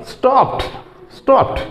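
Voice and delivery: स्टॉप्ड cut off on a T; final T sound. Shown as a demonstration. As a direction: close स्टॉप्ड with T, no extra syllable. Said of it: "last पे टी की sound पैदा हो रही है". (स्टॉप्ड को ट पर ख़त्म करें)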